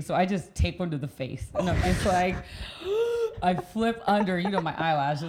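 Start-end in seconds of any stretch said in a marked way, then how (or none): 2.4-3.4 sharp high gasp as the wave hits; a retold startled gasp. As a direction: gasp in shock. Wave hits her in the face.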